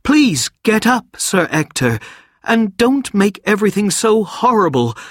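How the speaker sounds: put-on boy begging his kneeling guardian to rise, distressed. A narrator's reading. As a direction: beg him to get up, upset. Wart distressed, begging Sir Ector.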